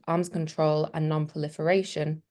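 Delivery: ordinary delivery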